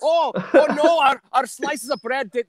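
high, loud hammed-up Scottish; Scottish accent. Shown as a demonstration. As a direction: put on a comic Scottish accent, pitched high. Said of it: "here's me being Scottish".

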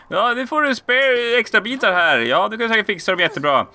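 chirpy NPC wishing you luck; shopkeeper voice. Acted. NPC voice continues after aside.

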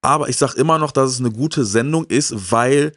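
ordinary talk, nothing marked

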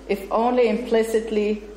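ordinary talk

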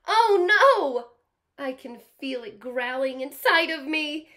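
high, loud frightened cry; alarmed crocodile. Acted. Teacher voicing the crocodile to kids, loud alarmed cry.

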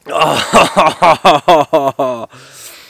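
loud drawn-out pulsing "ahhh" after a drink; long loud exhale-laugh. Real, not acted. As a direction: let out a big, pulsing laugh of relief. Just downed the beer.

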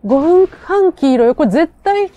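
loud and thrilled, voice up high; cheering as the win gets close; excited. Real, not acted. Excited as the fight turns.

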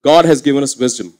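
ordinary delivery

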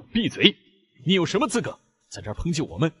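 高声怒喝; 气急败坏地呵斥; 勃然大怒. Acted. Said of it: "丽丝勃然大怒". (愤怒地喝止)

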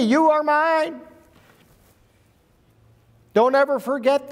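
preacher rising to the Father's words; fervent preaching. Acted. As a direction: lift the voice and proclaim it. Raised preaching on the Father's love.

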